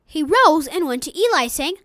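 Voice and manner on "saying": normal talking voice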